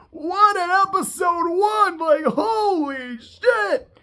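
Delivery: real thrilled exclamation; excited. Real, not acted. Thrilled reaction to the episode.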